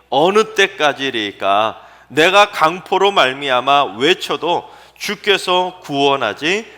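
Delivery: slow, loud, drawn-out recital of the verse; solemn scripture reading. Acted. Preacher reading Habakkuk's cry to the congregation, slow and loud. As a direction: read the lament slowly and loudly.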